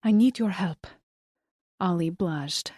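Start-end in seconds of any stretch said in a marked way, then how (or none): 0.0-0.9 put-on pleading Colombian-accented woman; lightly voiced Colombian plea. A narrator's reading. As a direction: ask for help in a Colombian accent. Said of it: "in her thick Colombian accent".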